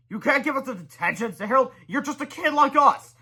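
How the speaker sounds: put-on high indignant kid voice. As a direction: protest like a fed-up boy. Harold protests to Melvin.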